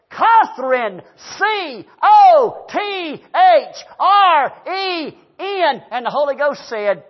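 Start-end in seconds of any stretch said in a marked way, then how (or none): 0.1-5.8 loud, high, slow spelling letter by letter; snapped, shouting. Retold or imitated. Fed-up customer into drive-through speaker, snapped shout. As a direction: blast each letter separately into the speaker. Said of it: "I snapped".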